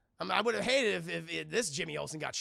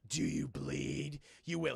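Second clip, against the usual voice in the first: much lower, very slow menacing line; mock menacing villain; 5 semitones lower, slower than usual. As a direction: growl it out like a brooding villain. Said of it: "he doesn't grab Batman and go".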